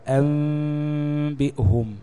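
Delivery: أنبئهم with the mim held long and nasal, lips barely apart; nasal hidden mim. Shown as a demonstration. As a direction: stretch the mim in أنبئهم into a long humming ghunna. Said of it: "هتتنطق إزاي؟".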